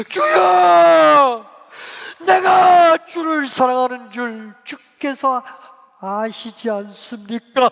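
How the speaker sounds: loud, high, drawn-out wail; acted sobbing shout, slowly easing down; overwhelmed, tearful cry. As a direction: cry out from an overflowing heart. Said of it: "막 우는 거예요".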